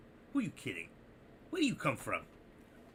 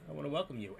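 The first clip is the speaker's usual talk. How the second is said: ordinary talk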